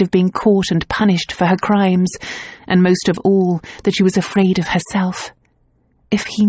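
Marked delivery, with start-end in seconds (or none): none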